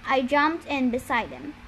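plain reading voice

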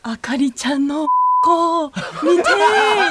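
put-on slow leering old man, stretched out; creepy old man voice. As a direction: drawl it like a lecherous uncle. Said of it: "喋り方とかちょっとおじさんに寄せる".

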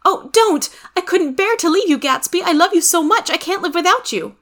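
high-pitched woman's voice, pleading love; high, imploring lover; pleading Daisy. A narrator's reading. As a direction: plead high and ardent, as a woman begging to stay. Acts Daisy pleading to stay.

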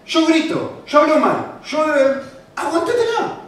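high-pitched mock defiance; hammed-up take-it-or-leave-it; brazen unrepentant person. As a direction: declare faults with pushy insistence.

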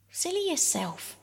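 retort. A narrator's reading. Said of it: "retorted Phyllis".

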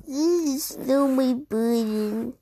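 slow, drawn-out toad voice; slow, mildly put-out toad. Acted. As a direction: draw the words out in a put-on character voice. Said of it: "voicing Toad".

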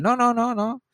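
an overexcited fan waving off the compliment; eager denial. Retold or imitated. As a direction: brush it off eagerly, voice jumping up. Fan insists he is no star.

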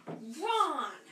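sharp, high shocked call; loud call. A narrator's reading. Prefect Percy catches his brother, loud startled call. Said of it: "a loud voice".

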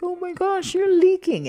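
high, loud imitation of shocked dismay; mock alarm. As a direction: exclaim it like a sitcom alien spotting tears. Imitates sitcom aliens reacting to tears.

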